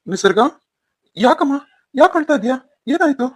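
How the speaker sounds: high, loud, alarmed father calling to his daughter; worried father. Acted. Father hears daughter crying.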